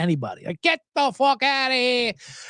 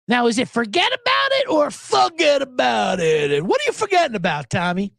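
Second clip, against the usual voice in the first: mock Italian forget about it; 3 semitones higher than usual. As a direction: shout it like a mob movie. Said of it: "what forget about it means".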